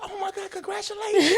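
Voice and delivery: high mock squeal of delight; excited congratulations. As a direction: imitate a thrilled, high-pitched congratulation. Retelling boss's reaction to proposal news.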